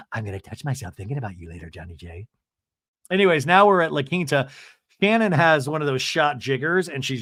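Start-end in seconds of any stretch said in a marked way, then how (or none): 0.1-2.3 much lower, much softer, fast; mock sultry hush. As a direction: drop to a breathy bedroom murmur. Mock sultry phone line.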